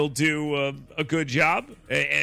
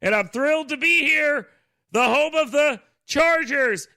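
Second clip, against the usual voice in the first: wrestler trash talk; 8 semitones higher, 4 dB louder than usual. Retold or imitated. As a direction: drag it out to rile the crowd. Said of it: "that professional wrestling thing".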